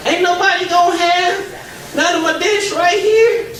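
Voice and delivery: high, slow put-on old auntie voice; indignant auntie. Aunt Helen wonders why nobody touches her olive dish, in a high slow auntie voice. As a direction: play the proud aunt guarding her dish.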